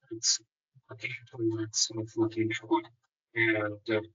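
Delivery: muffled, like a hand over the mouth. Real, not acted. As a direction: speak through a covered mouth. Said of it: "he's very muffled".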